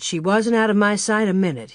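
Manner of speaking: usual speaking voice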